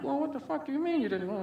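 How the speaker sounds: high, sharp angry parent voice; dad starting an argument, imitated; parents arguing. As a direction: mimic a fight heard through the window. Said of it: "an argument starting".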